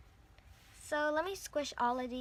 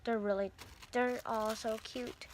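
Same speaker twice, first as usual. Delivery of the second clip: ordinary delivery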